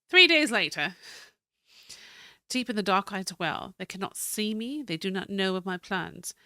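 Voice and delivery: steady, storytelling reading of a keeper's journal; diary read aloud. Acted. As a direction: read the old journal like a tale. Reads further journal entries.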